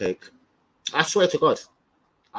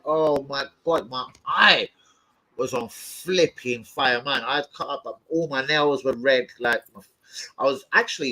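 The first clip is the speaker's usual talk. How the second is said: ordinary delivery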